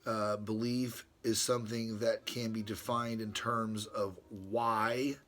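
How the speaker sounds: reading his letter to Brother Ron aloud, formally. Acted. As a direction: read it like a solemn treatise. Reads from page, end quote.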